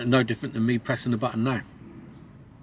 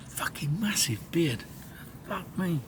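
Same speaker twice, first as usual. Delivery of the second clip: slow whispered aside; whispering; 5 semitones higher, 6 dB quieter, slower than usual. Real, not acted. Whispered joke about his beard.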